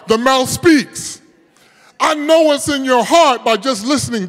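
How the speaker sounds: emphatic sermon, voice lifted; full-force preaching. Real, not acted.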